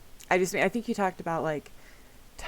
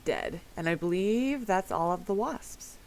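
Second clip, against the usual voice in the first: ordinary delivery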